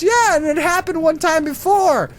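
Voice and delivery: high, aggrieved old lady impression; put-on indignant old lady; elderly woman. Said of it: "it's this old elderly woman".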